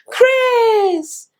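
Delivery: mock an excited kid running up to someone; child calling out. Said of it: "run up to you like a child".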